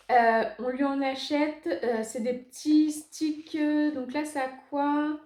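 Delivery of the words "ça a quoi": ordinary talk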